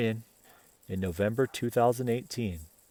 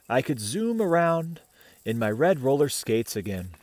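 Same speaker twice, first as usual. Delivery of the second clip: put-on gentle read-aloud voice for children; picture book reading to kids; storybook narration; 2 semitones higher, 4 dB louder than usual. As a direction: tell it like a bedtime story.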